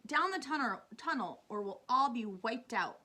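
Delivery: loud, urgent warning; crying out. A narrator's reading. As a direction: shout everyone toward safety. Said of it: "cried James".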